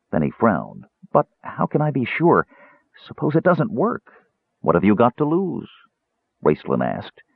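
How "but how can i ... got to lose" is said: high, worried kender questions; lightly voiced kender, unsure; doubtful kender.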